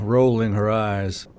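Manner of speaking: normal talking voice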